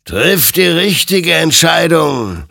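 high, slow, loud admonition; warning. A narrator's reading. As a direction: warn him solemnly as the spirit. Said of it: "ermahnte ihn sein Gegenüber".

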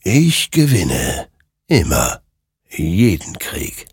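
slow, otherworldly final pronouncement; ominous creature. A narrator's reading. Creature telling Hector it always wins, slow and ominous. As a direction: let it land like a doom. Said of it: "murmelte dieser".

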